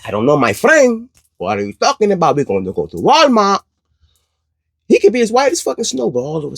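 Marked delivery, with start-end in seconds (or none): 0.0-3.6 higher-pitched put-on Spanish accent; mock Spanish accent. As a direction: imitate a man with a Spanish accent. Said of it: "he has a Spanish accent".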